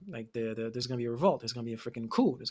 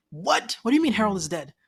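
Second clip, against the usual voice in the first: high, louder stunned outburst; shocked disbelief; 6 semitones higher, 6 dB louder than usual. Retold or imitated. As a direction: hear the news and recoil in disbelief. Voicing Thorkel hearing news of death.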